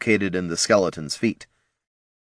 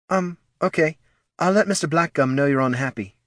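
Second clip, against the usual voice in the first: put-on hesitant girl; 8 semitones higher than usual. A narrator's reading. Girl placating boss.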